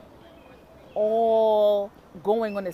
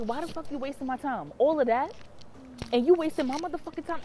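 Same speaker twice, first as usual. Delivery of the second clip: retold angry outburst; 6 semitones higher than usual. Confronting her over wasted time, retold indignation. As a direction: replay the telling-off with mild heat. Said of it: "why did I get so angry like that?".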